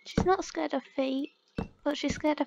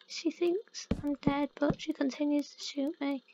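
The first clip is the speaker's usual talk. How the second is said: ordinary talk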